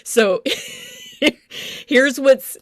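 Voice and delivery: ordinary talk, nothing marked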